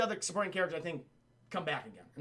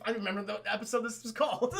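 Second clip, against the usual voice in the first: ordinary delivery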